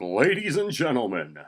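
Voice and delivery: high, loud arena announcer. Acted. Teen at the gym microphone, announcer voice. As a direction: call the crowd to attention. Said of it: "just channeled it".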